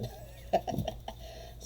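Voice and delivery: breathy, voiceless chuckle; real airy giggle; amused laugh. Real, not acted. Amused at being alarmed.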